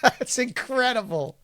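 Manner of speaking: high, slow, laughing amazement. Real, not acted. Co-host reacting to the impression contest win, laughing. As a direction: say it through a laugh, drawn out.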